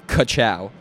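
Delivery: punchy catchphrase delivery; catchphrase call-out. Retold or imitated. Teammate answering the pre-race chant, punchy call-out. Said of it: "another person would say".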